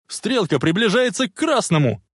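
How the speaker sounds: high, alarmed warning; yelling. A narrator's reading. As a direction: yell that danger is close. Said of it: "заорал Марти". (тревожный крик)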